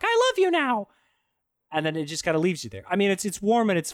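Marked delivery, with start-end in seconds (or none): 0.0-0.9 much higher mock love confession; mock lovestruck girl. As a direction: parody her sudden swoon, voice pitched way up. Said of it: "and she's just like".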